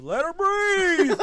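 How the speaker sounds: loud, high, slow yell broken by laughter; dad heckling the wedding kiss with a yell; laughing yell. Retold or imitated. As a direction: bellow it across the room through laughter. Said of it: "my papa again goes".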